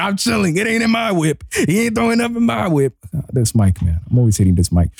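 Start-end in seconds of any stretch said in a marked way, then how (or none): 0.0-3.0 hammed-up giddy boasting; gleeful relief. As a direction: chant it way up high in triumph. Re-enacting his relieved celebration.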